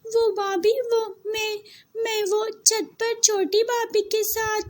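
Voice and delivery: high, stammering, close to tears; a frightened girl stumbling over her excuse; scared stammer. Acted. Scared girl stammers to scolding elder. (सहमी हुई हकलाती लड़की)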